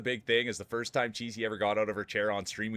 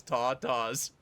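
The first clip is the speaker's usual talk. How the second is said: mimicking Sadie; 3 semitones higher than usual. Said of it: "That's what Sadie was calling them".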